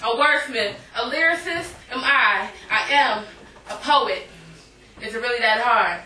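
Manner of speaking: rhythmic spoken word performance; poem. Acted. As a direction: perform it like slam poetry on stage.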